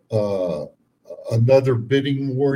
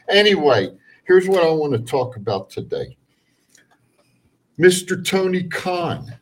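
louder, higher fired-up host; rant show host; 4 semitones higher, 5 dB louder than usual. Acted. Hosts his rant segment.

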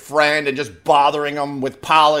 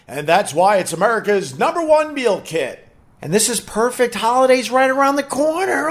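ad read; 4 semitones higher than usual. Acted. Reads sponsor ad for HelloFresh.

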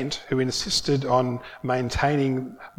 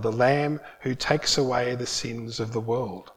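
normal talking voice

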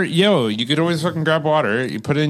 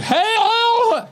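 very high squawked cartoon greeting; Donald Duck call. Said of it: "he's like".

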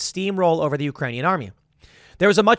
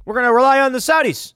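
fast, higher, louder old-politician impression; Joe Biden; 9 semitones higher, 5 dB louder, faster than usual. Mocking the president waving off American energy, fast and loud. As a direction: rattle it off like a folksy old president. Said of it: "Joe Biden comes in and he's like".

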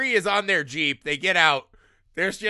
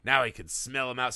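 lower, soft grim legend telling; low ominous voice; 8 semitones lower, 5 dB quieter than usual. Retold or imitated. Friend retelling the trapper's tragic backstory, low and ominous. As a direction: spin it like a dark campfire tale.

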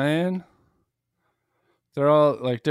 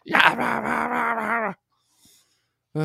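mock [name] shouting; 10 semitones higher than usual. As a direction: yell the blahs like a rocker. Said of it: "he keeps shouting at me".

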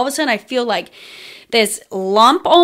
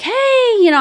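1 bright greeting; 13 semitones higher than usual. Retold or imitated. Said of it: "I was like".

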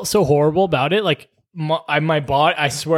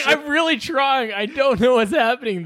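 flustered pleading; 8 semitones higher than usual. Retold or imitated. Flustered listener excuses themselves to the patient, pleading. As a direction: plead helplessly, pitched well up.

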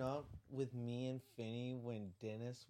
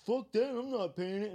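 loud, higher mock-angry customer; retold angry refusal; 8 semitones higher, 10 dB louder than usual. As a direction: refuse loudly, offended.

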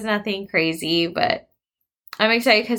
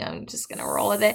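ordinary talk, nothing marked

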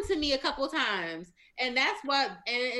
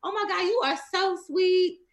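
fake nice; 7 semitones higher, 6 dB louder than usual. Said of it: "I try to be nice".

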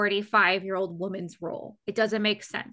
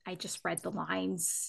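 quiet; 3 semitones lower, 10 dB quieter, slower than usual. Retold or imitated. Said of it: "I was like".

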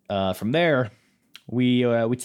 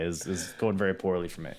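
normal talking voice

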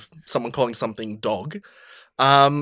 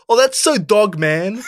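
higher and louder mock call-out, blurted; 5 semitones higher, 4 dB louder, slower than usual. Kid calling out in religious education class, loud mock blurt. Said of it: "he just called out loud".

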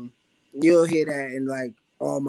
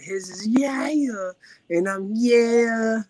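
comparing yeah ad-libs; 9 semitones higher, 6 dB louder, slower than usual. Shown as a demonstration.

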